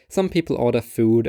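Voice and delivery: ordinary delivery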